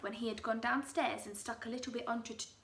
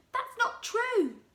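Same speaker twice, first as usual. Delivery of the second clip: put-on high, slow protesting old wife; Mrs Twit crying out; 12 semitones higher, 8 dB louder, slower than usual. Wife protesting to her husband, high and slow. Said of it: "cried Missus Twit".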